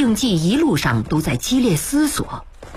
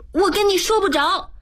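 put-on high-pitched, dismissive girl's voice; high girlish retort; rebuffing girl; 12 semitones higher than usual. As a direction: brush him off in a high voice. Girl rebuffs him while leaving.

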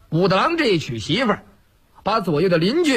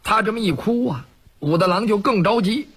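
ordinary delivery

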